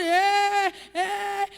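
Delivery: loud hyped cheering; crowd cheer. Retold or imitated.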